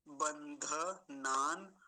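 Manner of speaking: बंधनान drawn out as ban-dha-naan; careful syllables. Shown as a demonstration. Teacher modelling the mantra word, careful slow syllables. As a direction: say बंधनान slowly, full dha, long naan. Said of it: "अब इसमें बंधनान, पहले शब्द को देखेंगे".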